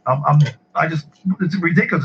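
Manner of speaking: normal talking voice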